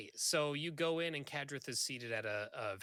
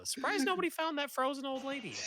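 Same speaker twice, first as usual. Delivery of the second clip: mocking repeat; 13 semitones higher than usual. GM imitates player's line, laughing.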